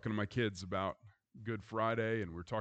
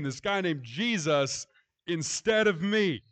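loud, higher, building refrain on instead of me; preaching at full force; 8 semitones higher, 6 dB louder than usual. Acted. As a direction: drive the refrain home like a climax.

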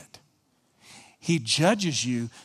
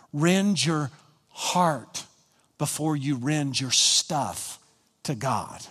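slow, weighty emphasis; slow and deliberate; slower than usual. Real, not acted. Preacher stressing to the congregation, slow and deliberate. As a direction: lay each word down slowly. Preacher slows to drive it home.

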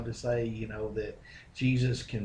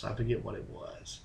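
whisper; 2 semitones lower, 8 dB quieter than usual. Real, not acted. Aside while recalling.

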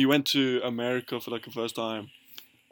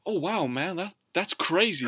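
higher-pitched gullible wonder; mock amazement; 5 semitones higher than usual. Imitating Americans who believe his brother's tale, naive amazement.

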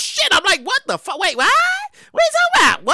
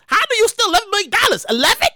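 normal talking voice

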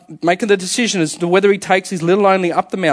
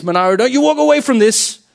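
higher-pitched, insistent director; raised-voice imitation of a director; confronting director; 7 semitones higher, 4 dB louder than usual. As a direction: call him back in mock indignation. Director confronting dancer in retold scene.

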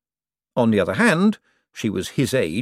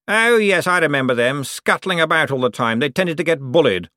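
higher, loud lordly tone; condescending lord to servant; Cosmo's voice; 6 semitones higher, 4 dB louder than usual. Acted. To his butler, Cosmo's lofty patronizing voice.

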